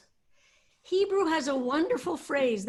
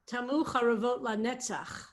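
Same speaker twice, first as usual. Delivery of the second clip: ordinary talk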